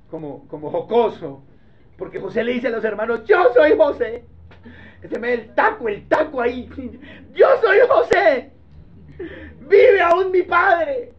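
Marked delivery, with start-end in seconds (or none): 3.3-4.2 higher, louder declaration; dramatic reveal. Acted. Joseph to his brothers, loud dramatic reveal. 7.3-11.1 much higher, louder, slow and crying; Shakespearean tearful reveal. Acted. Joseph to his brothers, theatrical tearful reveal. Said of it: "Parece puro Shakespeare".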